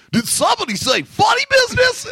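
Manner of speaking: high goofy shout; loud clown voice. Retold or imitated. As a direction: yell it like a clown. Clown shouting across bus.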